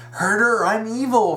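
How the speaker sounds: mocking quote. Fan mocking Psycho Mantis's return to evil, mockingly. Mocks a villain's senseless turn.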